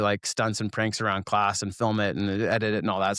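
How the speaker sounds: ordinary delivery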